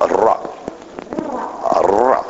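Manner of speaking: Ra with a trilled R, short and clipped; rolled R. Shown as a demonstration. Preacher teaching the congregation a Hebrew word, rolled R. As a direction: roll the R hard on Ra. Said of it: "Can y'all roll your R?".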